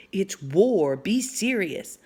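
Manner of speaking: ordinary delivery, nothing marked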